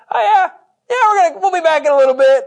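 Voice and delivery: high-pitched, loud, offhand "oh yeah"; breezy mock reply. Abraham telling his young men they'll be back, breezy and high-pitched. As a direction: toss it off high and quick, without a care. Voices Abraham reassuring servants, unemotional.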